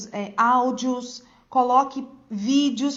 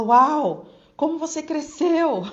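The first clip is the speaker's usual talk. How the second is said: loud wow, then higher-pitched marvel; amazed exclamation; 3 semitones higher, 4 dB louder, slower than usual. Acted. Teacher greeting a pupil who has grown, amazed.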